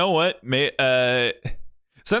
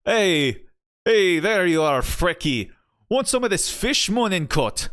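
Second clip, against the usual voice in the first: higher, bouncy Irish character voice; lively Irish greeting, pitched up; Irish accent; 6 semitones higher than usual. Acted. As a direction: call out in a lilting Irish brogue. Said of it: "an Irish accent for our boy".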